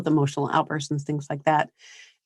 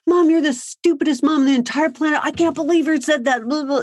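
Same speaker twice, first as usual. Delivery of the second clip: mock furious teen, pitched high; imitating an angry child yelling at mom; bratty kid; 9 semitones higher than usual. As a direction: play the kid insulting mom, high and whiny. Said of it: "They might be saying something to you like".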